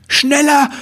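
high, urgent shout; yelling. Acted. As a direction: urge the weasel on at full speed. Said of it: "brüllte Ribbelwitsch".

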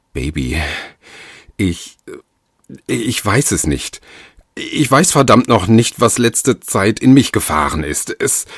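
halting start building to a high, loud outburst; agitated, stumbling confession; distraught faltering. A narrator's reading. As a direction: grope for words, then burst out in frustration. Husband falters through agitated apology.

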